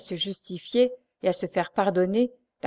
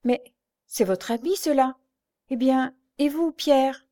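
higher, startled questioning; sudden exclamation; 6 semitones higher, 4 dB louder than usual. A narrator's reading. Blind girl suddenly noticing her friend's coat, exclaiming. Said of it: "tout à coup elle s'écria".

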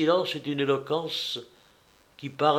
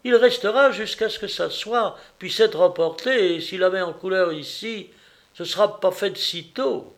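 higher-pitched wife setting him straight; contradicting wife; 6 semitones higher, 3 dB louder than usual. Acted. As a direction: argue the point in a raised woman's voice. Narrator voices the contradicting wife.

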